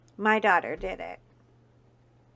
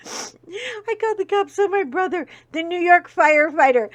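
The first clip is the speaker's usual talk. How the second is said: laughing disbelief; 7 semitones higher, 9 dB louder than usual. Real, not acted. Laughing disbelief at own story.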